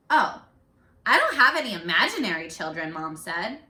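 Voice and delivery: expressive picture-book reading; read-aloud voice for young kids; reading to children. A narrator's reading. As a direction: carry the class to the story's ending. Teacher reads picture book aloud.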